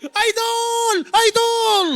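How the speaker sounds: high squealing fan cry; fan scream. Retold or imitated. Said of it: "Yung sigaw mo talagang".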